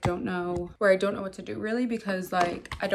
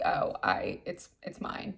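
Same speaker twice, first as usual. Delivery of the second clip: sheepish realization; 12 semitones lower, 8 dB quieter, slower than usual. Retold or imitated. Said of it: "And then I realized".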